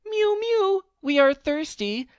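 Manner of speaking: very high, squeaky cat voices; mewing cats. A narrator's reading. Thirsty cats crying to the old man, high mewing. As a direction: mew like a crowd of kittens. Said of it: "cried the hundreds of cats".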